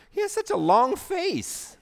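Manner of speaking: put-on high, slow little girl voice; little girl's voice. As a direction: deliver the punchline as a small child, high and slow. Punchline in girl's voice.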